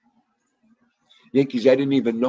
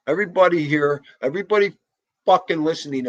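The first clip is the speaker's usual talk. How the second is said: higher and louder call to everyone; 6 semitones higher, 4 dB louder, faster than usual. Real, not acted. Calling out to everyone listening in the chat.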